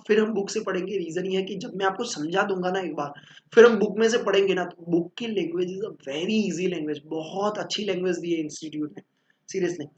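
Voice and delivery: normal talking voice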